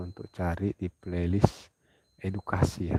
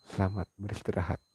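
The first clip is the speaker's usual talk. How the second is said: usual speaking voice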